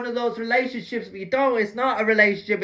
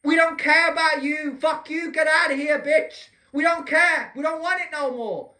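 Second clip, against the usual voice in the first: mock angry shouting; 6 semitones higher than usual. Vlogger mimics politicians telling people off, shouted. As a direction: yell it like a sneering politician.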